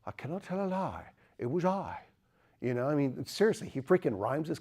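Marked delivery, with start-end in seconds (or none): 0.0-2.0 a light Scottish brogue for George Washington, set a touch higher; lightly put-on Scots brogue, softly intoned; the teacher's hammed-up Scots brogue for Washington's confession; mock Scottish brogue. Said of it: "that's my British Scot, you know, brogue, George Washington".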